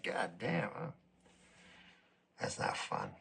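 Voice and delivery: very low and quiet; hushed, deflated resignation; weary dismay. Acted. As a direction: let it sink to a defeated murmur. Acted morning dread, life sinks in.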